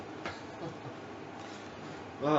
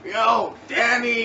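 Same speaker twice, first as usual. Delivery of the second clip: mock call-out; 5 dB louder, slower than usual. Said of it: "did he call you and was like".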